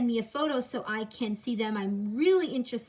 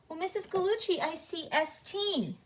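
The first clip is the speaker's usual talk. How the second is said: higher, softer voice of a pupil piping up; imagined pupil objecting; 7 semitones higher, 4 dB quieter than usual. Pretend. Pupil arguing with the teacher over letters, higher and softer objection. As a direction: lightly voice a pupil's polite objection. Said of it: "You could argue".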